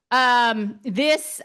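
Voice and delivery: usual speaking voice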